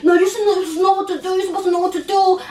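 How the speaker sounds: imitating a frustrated boyfriend nagging; boyfriend getting mad. Boyfriend scolding her over trip plans, mild mock anger. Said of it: "he's just getting mad at me like".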